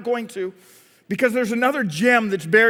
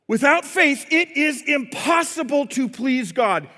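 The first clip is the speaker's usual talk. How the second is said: slow, higher, hammering out "impossible"; emphatic; 5 semitones higher, slower than usual. Real, not acted. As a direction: drive "impossible" home, stretched and forceful. Preacher hammers the verse home.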